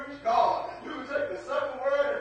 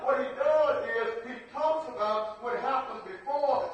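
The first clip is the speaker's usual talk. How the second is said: usual speaking voice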